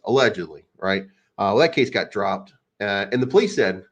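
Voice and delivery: normal talking voice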